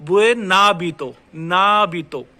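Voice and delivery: stretched "Buen hábito" with n carried onto the a; linked n. Shown as a demonstration. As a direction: slowly show ना in "ना बीतो". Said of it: "आगे वाले vocal पे match कर दो".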